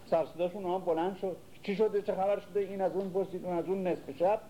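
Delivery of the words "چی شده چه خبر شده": alarmed servants. Retold or imitated.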